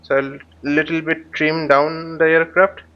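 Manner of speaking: ordinary delivery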